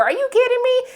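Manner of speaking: high-pitched, incredulous outburst; indignant disbelief. Acted. Vlogger answering the boyfriend's "are you sure", indignant. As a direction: throw it back at him in disbelief.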